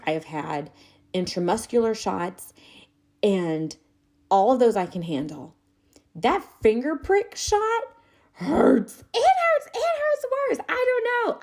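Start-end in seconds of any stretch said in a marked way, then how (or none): 6.2-11.4 high, squeaky pained whine. Real, not acted. Telling viewers about the finger prick, high pained whine. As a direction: squeal out how much it hurt.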